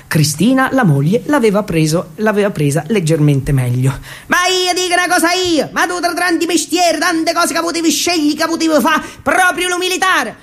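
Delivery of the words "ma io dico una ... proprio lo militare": mother's voice pitched higher, in dialect; higher, scolding southern mother. Acted. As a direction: nag him like a dialect-speaking mamma. Mother scolds son about army.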